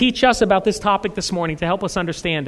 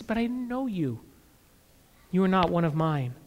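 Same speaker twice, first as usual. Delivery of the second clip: soft verdict; 4 semitones lower, 11 dB quieter than usual. Acted.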